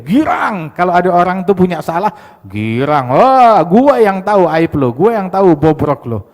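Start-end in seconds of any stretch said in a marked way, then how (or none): 3.1-6.2 gloating taunt. Retold or imitated. Imitating a gloater who knows your secrets, loud. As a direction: crow over their fault. Gloating over someone's fault.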